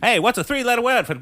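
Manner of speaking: put-on fast, high, loud old-time newsroom patter; hammed-up vintage newspaper-office voice; forties newspaperman. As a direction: rattle it off like a forties movie newshound. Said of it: "It's still, like, the forties in there".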